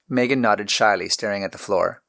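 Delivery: ordinary delivery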